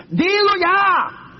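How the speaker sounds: high, very slow stretched command; commanding God. Retold or imitated. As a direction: drag out the order from above. God commands the frightened pastor.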